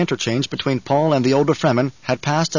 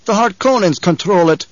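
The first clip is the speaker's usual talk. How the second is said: raised, louder objection; protesting; 6 semitones higher, 5 dB louder than usual. A narrator's reading. Gurney contradicting Paul over who controls spice, protesting. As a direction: object at once, pitched up. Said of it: "Gurney protested".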